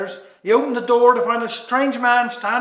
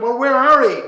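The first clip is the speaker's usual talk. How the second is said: louder call into the dark; shouting out; 3 semitones higher, 7 dB louder than usual. Acted. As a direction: call out into the night. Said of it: "he shouted".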